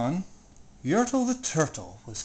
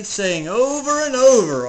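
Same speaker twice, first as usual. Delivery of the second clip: usual speaking voice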